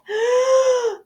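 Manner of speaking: huge deep breath sucked in; loud dragon inhale; big gasp. A narrator's reading. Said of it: "took a huge deep breath".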